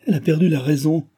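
high, quick, alarmed realisation; dismayed exclamation. A narrator's reading. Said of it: "s'écria Delville".

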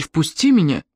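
understated angry order; angry demand. A narrator's reading. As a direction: demand entry with a touch of anger. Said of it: "Тоби со злостью заколотил в дверь".

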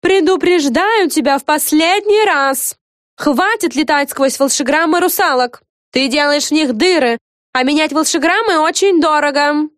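high, loud, cross telling-off; final-warning crossness, high-pitched character; angry scolding. A narrator's reading. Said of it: "раздался сердитый голос".

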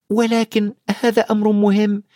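put-on high-pitched boy's objection; earnest high child protest; Harry, protesting. A narrator's reading.

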